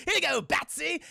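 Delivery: high, teasing villain; Joker. Retold or imitated. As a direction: taunt with a playful lilt. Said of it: "Joker's like".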